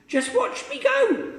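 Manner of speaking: put-on very high old woman calling out. Grandma calling down to George, very high and loud. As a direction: call down gleefully to the boy.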